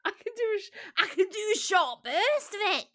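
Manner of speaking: a short aggressive burst of fake Scottish; terrible Scottish accent.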